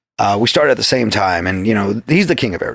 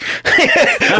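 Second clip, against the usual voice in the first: real laugh, jumping way up; cracking up at his own slip; high burst of laughter. Real, not acted. Laughing after saying the wrong club name, high burst.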